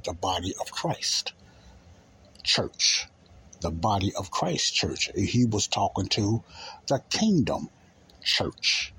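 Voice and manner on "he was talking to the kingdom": normal talking voice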